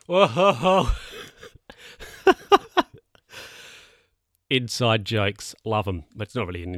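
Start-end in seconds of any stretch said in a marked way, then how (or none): 0.1-0.9 teasing whoa-ho-ho. Real, not acted. Teasing over bun-in-oven joke. 2.0-2.8 a sudden high squeal of laughter; real laughter squealed up high. Real, not acted. Laughs at own joke.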